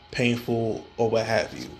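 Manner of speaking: ordinary delivery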